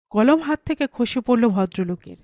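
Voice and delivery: plain reading voice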